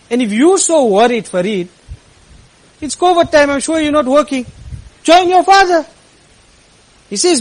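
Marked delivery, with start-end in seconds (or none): 0.1-5.9 rising to a loud, higher challenge at "Join your father"; raised rebuke. Retold or imitated. Imam scolding Farid over the phone, building loud. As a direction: build from firm to a near shout.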